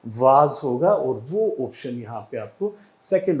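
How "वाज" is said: वाज with a long ah and z, "vaaz"; British pronunciation. Shown as a demonstration. Teacher answers their own question, British pronunciation. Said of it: "British pronunciation इसका क्या होगा?".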